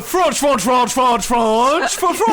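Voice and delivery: mock French gibberish, chanted; fake French. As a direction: babble fake French sounds. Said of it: "what fake language you're supposed to be doing".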